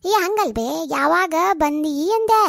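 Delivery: slow, drawn-out repeated question; repeating for the hard-of-hearing. Acted. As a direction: stretch every word so a deaf listener gets it. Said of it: "ಕಿವಿ ಕೇಳಲ್ಲ ಅನ್ಸುತ್ತೆ". (ಕಿವಿ ಕೇಳದವರಿಗೆ ನಿಧಾನವಾಗಿ ಎಳೆದು ಕೇಳುವುದು)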